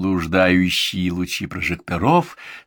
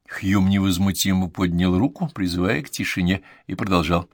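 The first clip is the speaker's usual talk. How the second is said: ordinary delivery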